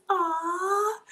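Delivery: high, emotional "ah" of being touched; a sudden squeal of delight at the compliment; touched high cry. Real, not acted. Moved by viewer's compliment.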